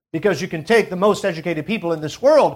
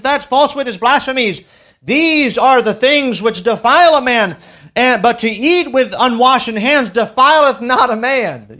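louder preached list of sins; forceful scripture reading; 5 semitones higher, 6 dB louder than usual. Acted. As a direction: hammer out each sin in turn.

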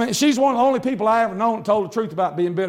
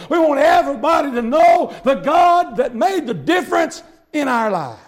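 high, loud shouted preaching; 7 semitones higher, 4 dB louder than usual. Acted. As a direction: lift the congregation with it. Preaching at full force.

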